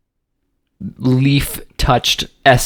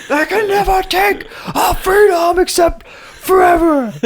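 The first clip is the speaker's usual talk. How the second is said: high, slow, wailing mock battle cry; mock Braveheart cry; slower than usual. Parodying the Braveheart freedom speech with a wailing, tearful cry.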